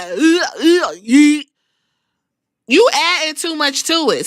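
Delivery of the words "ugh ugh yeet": grunt, grunt, then shout yeet; imitating Jey Uso. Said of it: "now Jay Uso is like".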